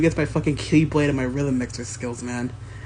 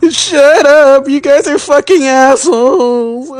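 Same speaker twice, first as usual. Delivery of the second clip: much louder, higher mock-furious yell; angry shout; 9 semitones higher, 15 dB louder, slower than usual. Teased friend yelling at his roasters, angry shout. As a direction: blast them for roasting you.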